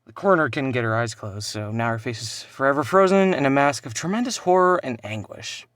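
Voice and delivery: ordinary delivery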